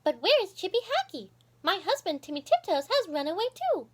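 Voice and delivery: high, worried squirrel wife; Goody's voice. Acted. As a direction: ask anxiously after her husband. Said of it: "said Missus Goody Tiptoes".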